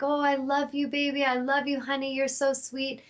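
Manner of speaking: high, sing-song doting voice; sweet baby talk to a beloved dog; affectionate pet talk. Retold or imitated. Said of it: "I was just talking to her".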